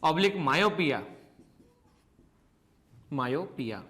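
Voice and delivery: slow, spaced-out dictation with long pauses. Acted. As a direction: read it out slowly so students can write every word. Dictating notes to the class.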